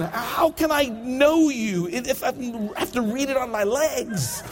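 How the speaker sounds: high, slow plaintive whine to God; hammed-up drawn-out grievance; exasperated mock complaint. Passenger trying to read, complaining to God in mock anguish.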